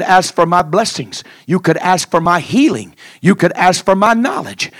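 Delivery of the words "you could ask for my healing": ordinary talk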